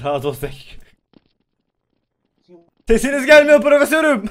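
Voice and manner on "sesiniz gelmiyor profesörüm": high, loud call to be heard; shouting. Pretend. Student tells professor he's inaudible, shouting. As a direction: call out as to someone hard of hearing.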